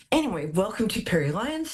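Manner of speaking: normal talking voice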